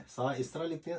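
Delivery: normal talking voice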